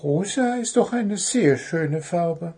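high, innocent bird voice; high-pitched storybook bird; unruffled little bird. A narrator's reading. Unruffled bird praises pink.

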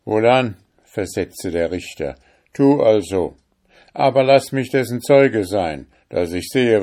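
loud, high demand; commanding judge. Acted. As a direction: insist on being a witness. Richter fordert, Zeuge zu sein. (laut fordernder Richter)

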